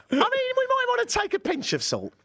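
very high-pitched comic squawk; high, silly protest; mock outburst. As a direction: squeal it in mock disbelief. Mocking outburst in comic rant.

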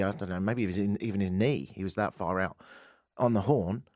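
normal talking voice

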